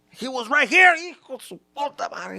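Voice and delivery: high, loud frantic father; a dad searching for his lost son. Retold or imitated. Dad panicking, cursing.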